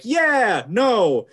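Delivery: high, loud yeah dropping into no; cheer, then catch yourself; cheer turning to dismay. Retold or imitated. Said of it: "it's like".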